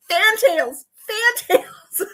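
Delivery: rising excited repeat of Fantails, going high; urgently correcting the typo, voice climbing; frantic mock insistence. Typing frantically to Daz, high excited Fantails. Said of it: "I'm on my phone going".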